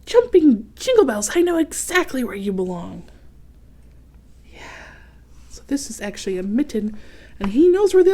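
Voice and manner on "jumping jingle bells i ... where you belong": excited exclamation. Acted. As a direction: burst out with joy. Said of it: "Otto gasped".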